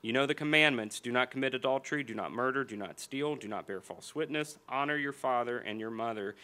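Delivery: usual speaking voice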